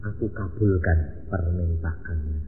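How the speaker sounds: low, slow gentle reply from God; quiet reassuring God voice; calm divine answer. Retold or imitated. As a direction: grant it softly and calmly. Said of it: "Bapak bilang apa".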